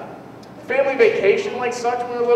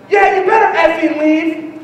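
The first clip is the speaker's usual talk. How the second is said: tough guy; 6 semitones higher, 5 dB louder, slower than usual. Retold or imitated. Guy threatening them.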